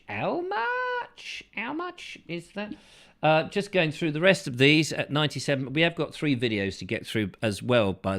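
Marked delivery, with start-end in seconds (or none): 0.1-2.8 sudden squeaky high voice, mock shock at the price. Host squeaks a mock customer asking the price. Squeaky follow-up after sponsor line.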